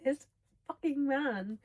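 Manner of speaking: giddy swooning outburst; excited. Real, not acted. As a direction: gush about him, giddy. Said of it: "literally can't control my excitement".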